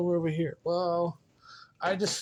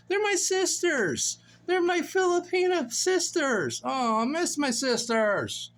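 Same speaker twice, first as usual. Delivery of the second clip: playful pining; 10 semitones higher than usual. Real, not acted. Missing Filipina sisters, playful high whine.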